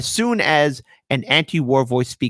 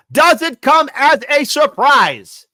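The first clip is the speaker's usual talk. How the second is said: much higher, slow drawn-out sarcasm; exaggerated mock surprise; 11 semitones higher, 5 dB louder, much slower than usual. Real, not acted. Sneering at liberal voters with a much higher, slow sarcastic drawl. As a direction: stretch it out with sarcasm. Repeat, bigger.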